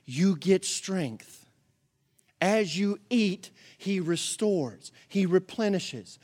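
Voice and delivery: slow, tender, spaced-out reassurance; gentle slow comfort. Acted. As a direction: linger on each promise to soothe. Preacher slows to a gentle comfort.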